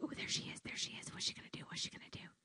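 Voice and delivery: hushed unvoiced whisper of onlookers. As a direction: gossip under your breath. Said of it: "everybody's like".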